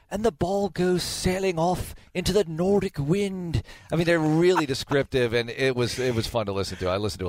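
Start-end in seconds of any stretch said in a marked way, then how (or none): 0.1-3.6 slow, higher-pitched British commentary; florid, drawn-out British play-by-play; British announcer. Retold or imitated. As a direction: paint the shot like a British announcer. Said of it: "And they're so descri".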